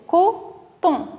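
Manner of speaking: "coton" in two detached beats, co, ton; syllable by syllable. Shown as a demonstration. Teacher completing the word for children, "coton" said syllable by syllable. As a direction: separate co from ton in "coton" with a short pause.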